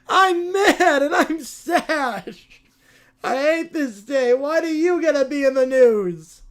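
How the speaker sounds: loud, slow, crying bratty wail; whiny spoiled kid in a tantrum. Acted. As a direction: throw a kid's tantrum, half sobbing.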